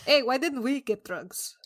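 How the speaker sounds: ordinary talk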